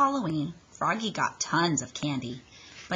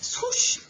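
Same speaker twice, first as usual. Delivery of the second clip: high whooshing cape sound. A narrator's reading. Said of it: "sound word for the cape".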